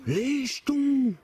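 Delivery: scared exclamation. Real, not acted. Startled by a voice, scared.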